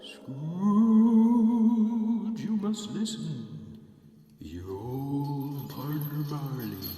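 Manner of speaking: wailing, drawn-out spectral voice; haunting spirit with long, swooping vowels; Marley's ghost. Acted. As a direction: moan a slow, eerie warning.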